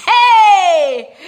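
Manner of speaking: high shrieking laugh-shout; loud gleeful yelp. Real, not acted. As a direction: whoop with delight. Celebrating the plan.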